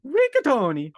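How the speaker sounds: loud, high Italian pasta shout; Italian accent. Pretend. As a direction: belt it like a cartoon Italian chef. Mario shouting pasta names.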